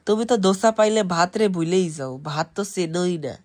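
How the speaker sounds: ordinary talk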